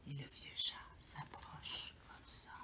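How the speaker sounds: soft, slow creeping whisper; storyteller whispering as the cat creeps; suspense whisper. A narrator's reading. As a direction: draw out the creep, barely voiced.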